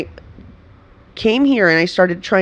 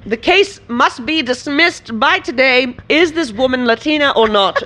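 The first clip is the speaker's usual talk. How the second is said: mock courtroom lawyer; 6 semitones higher than usual. Joking about the case as if in court, mock-dramatic lawyer.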